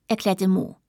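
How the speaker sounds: usual speaking voice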